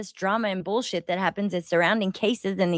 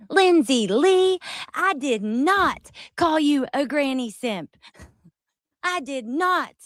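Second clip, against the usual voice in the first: high, slow, hammed-up indignant protest; mock passionate denial; 8 semitones higher, 3 dB louder, slower than usual. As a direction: imitate someone dramatically insisting on innocence. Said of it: "passionately claim you didn't do something".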